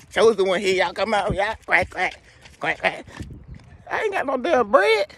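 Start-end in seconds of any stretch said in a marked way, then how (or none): 1.7-3.1 soft low duck quacks. Acted. Ducks imitated. 3.9-5.1 exasperated high complaint. Acted. Complaining to ducks.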